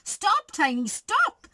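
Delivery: last stop jumps up high in alarm; alarmed yelp. A narrator's reading. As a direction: yelp a warning at the biting friend. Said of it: "yelped Philo".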